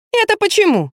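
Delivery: put-on high, amused girl's question without a laugh; giggly. A narrator's reading. Lazy girl questioning advice to write it herself, giggly. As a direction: ask it teasingly, pitch way up. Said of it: "захихикала лентяйка".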